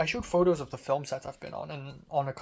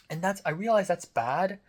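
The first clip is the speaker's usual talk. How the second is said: ordinary delivery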